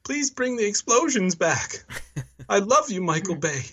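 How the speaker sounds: high pleading voice. Retold or imitated. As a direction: beg in a high small voice. Pleading body voice.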